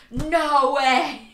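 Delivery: loud delighted exclamation; retold excited shout; astonished. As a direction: burst out in disbelief.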